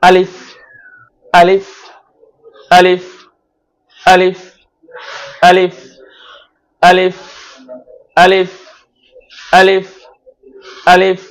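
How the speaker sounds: strong guttural alif from the throat; demonstrating alif. Shown as a demonstration. Teacher drilling students with alif, demonstration from the throat. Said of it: "দেখুন কিভাবে উচ্চারণ করবেন".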